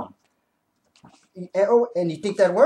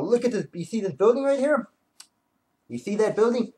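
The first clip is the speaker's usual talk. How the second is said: usual speaking voice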